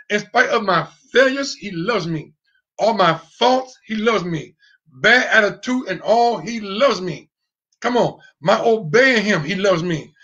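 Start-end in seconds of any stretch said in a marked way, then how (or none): none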